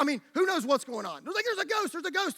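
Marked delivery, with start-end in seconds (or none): 1.4-2.4 terrified. Acted. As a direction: imitate people freaking out at a ghost. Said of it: "So they were terrified".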